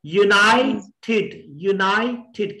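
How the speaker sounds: United slowly, pausing before the final TED; split into syllables. Shown as a demonstration. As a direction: break United as you-NAI, then ted. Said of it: "कितने syllables हैं". (United रुक-रुक कर, हिस्सों में)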